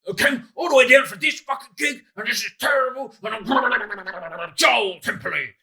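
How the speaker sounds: high, loud mock rant with garbled babble; hammed-up furious exit, loud and high; angry storming off. Comedian storming off stage after bombing, imitated angry. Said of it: "like, stormed off in anger".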